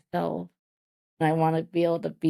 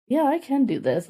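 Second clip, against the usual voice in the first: higher-pitched, confident self-talk; newfound self-belief; 8 semitones higher than usual. Retold or imitated. Speaker retelling her growing confidence in her thirties, self-assured. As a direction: quote yourself starting to believe you can.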